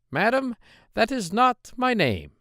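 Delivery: droll little man. Acted. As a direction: pitch him up high and sly. Dwarf refuses again.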